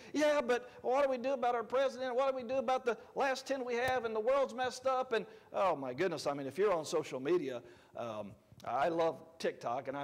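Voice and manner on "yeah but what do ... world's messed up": mock worried skeptic. Mocking a worried churchgoer.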